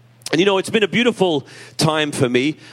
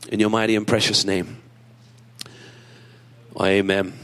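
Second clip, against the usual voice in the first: prayer. Acted. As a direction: pray aloud to God, unhurried and devout. Pastor leads the congregation in prayer.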